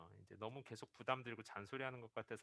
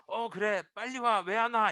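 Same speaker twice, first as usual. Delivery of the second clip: much higher, louder tipsy call; excited drunk shout; 10 semitones higher, 14 dB louder than usual. Retold or imitated. 취한 청년이 아내를 친구로 알고, 신나게 소리치며. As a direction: yell it like a friend late to the party. Drunk student mistakes wife for friend.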